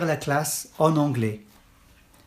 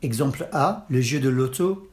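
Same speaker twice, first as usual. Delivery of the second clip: normal talking voice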